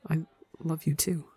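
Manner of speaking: soft, barely above a breath; hushed. Acted. Ranbu answering Tubbo's love, hushed and soft. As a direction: hush the words out gently. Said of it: "Ranbu hushes".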